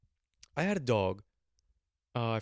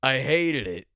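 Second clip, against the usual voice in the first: louder, flat verdict like a grumpy dad; old man dad voice; 2 semitones higher, 8 dB louder, slower than usual. Pretend. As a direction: announce it proudly like a forty-year-old father. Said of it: "Then I could say".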